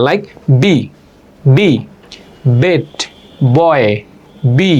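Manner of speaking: বি with both lips pressed, no puff of air; বি said with closed lips, no breath. Shown as a demonstration. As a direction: say বি with no air bursting out. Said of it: "দুইটা lip এক করে শুধু একটা sound".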